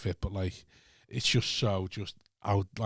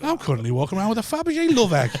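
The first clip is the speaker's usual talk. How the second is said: mocking posh lady; 10 semitones higher, 5 dB louder than usual. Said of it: "And you're there going".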